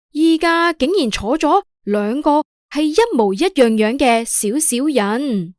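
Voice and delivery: ordinary delivery, nothing marked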